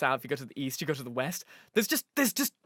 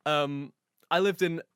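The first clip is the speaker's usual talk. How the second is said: usual speaking voice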